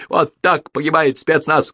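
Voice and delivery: slow, high, woeful declaration; mumbling mouthful. A narrator's reading. Soldier chewing paper in his last stand, mock-heroic and slightly mumbled. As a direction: lightly mumble a heroic farewell. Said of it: "промямлил".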